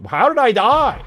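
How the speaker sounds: high, loud baffled outcry; surprised outcry. Real, not acted. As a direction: shout in disbelief. Dies in game.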